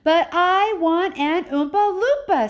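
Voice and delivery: high, loud, slow tantrum scream. Acted. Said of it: "screamed Veruca".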